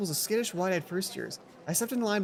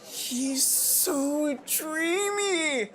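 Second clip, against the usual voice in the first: swooning; 7 semitones higher, much slower than usual. Acted. Mai swooning over Jared.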